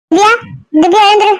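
calling out to wake her. Acted. Waking sleeping girl for dinner.

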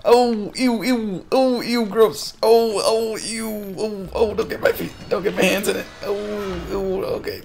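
high, whiny string of ews, easing off; hammed-up squeamish whimpering; squeamish disgust. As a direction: act out a mock grossed-out character. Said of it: "He's like, "Ew, ew ... gross".

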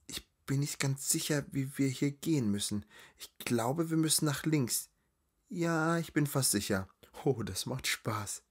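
high-pitched, airy character voice; flighty young noblewoman, unsure then delighted. Acted. Guide unsure of the way tells her friend, high and dithering. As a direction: think aloud in a high voice, growing sure.

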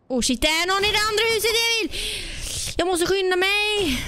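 high, rapid real alarm; urgent alarm. Real, not acted. Player spots someone in the other house, urgent high alarm. Spots enemy, alerts teammate Emil.

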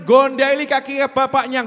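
usual speaking voice

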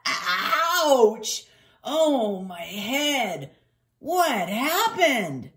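high, loud, groaning hurt dog; yelp, then moan in pain. Acted. Injured boxer in pain.

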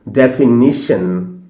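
ডেফিনিশন said slowly and carefully; ডেফিনিশন drawn out deliberately, the correct way; correct pronunciation. Shown as a demonstration. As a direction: spell out ডেফিনিশন syllable by syllable. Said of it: "ডেফিনিশন কিন্তু নয়".

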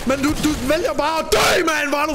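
exasperated gamer yelling at a partner; frustrated yell. Real, not acted. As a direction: yell at your teammate for giving up. Yelling at teammate who died.

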